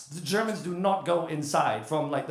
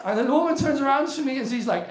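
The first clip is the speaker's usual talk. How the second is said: excited retelling; 10 semitones higher than usual. Retold or imitated. Comic to the crowd about the woman's question, laughing disbelief. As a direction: tell it rising with disbelief.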